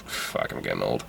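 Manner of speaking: low muttered lament; mock despair. Real, not acted. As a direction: groan it out, dejected. Mock lament about age.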